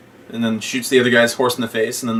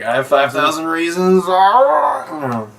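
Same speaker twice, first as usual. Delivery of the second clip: mock excited German; 6 semitones higher, 3 dB louder, slower than usual. Quoting German's offer.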